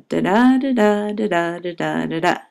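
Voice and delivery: steady da da beat, each syllable even; rhythm demo. Shown as a demonstration. Teacher demonstrating a sonnet's rhythm, steady da da beat. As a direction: tap out the meter with nonsense syllables. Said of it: "it's in a rhythm that goes like this".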